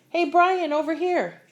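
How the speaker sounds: high, loud call across the room; shouting over. Acted. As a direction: wave him over with your voice. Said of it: "he hears someone shout".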